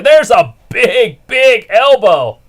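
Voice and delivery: building excitement, slow and loud; excited play-by-play. Real, not acted. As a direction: call the hot tag with hype. Calling the big moment.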